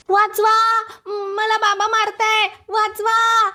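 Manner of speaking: high, loud drawn-out yells; crying for help. Acted. Boy being beaten by his father, high cries for help. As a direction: holler for rescue with stretched words.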